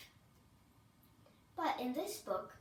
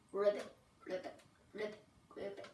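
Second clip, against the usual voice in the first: frog croaks; 6 semitones lower, faster than usual. Acted. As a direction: lower croaking ribbits like a frog.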